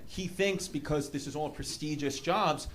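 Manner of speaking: ordinary delivery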